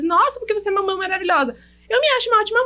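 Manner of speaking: quick, high-pitched mock gushing; mock self-congratulation. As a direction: play the self-satisfied mother lightly. Acting out mothers crediting themselves.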